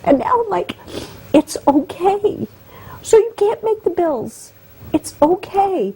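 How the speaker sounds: high, teary voice on the edge of crying; slow, fighting back real tears; tearful. Real, not acted. Guest explaining her emotions, tearfully. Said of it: "that's why I cry all the time".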